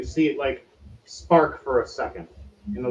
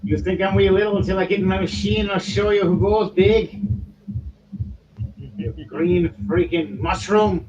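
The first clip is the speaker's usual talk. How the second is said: loud, raised, indignant gnome voice; feisty gnome threat; 7 semitones higher, 4 dB louder than usual. Acted. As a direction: square up to someone far bigger. Gnome threatens back at his captor.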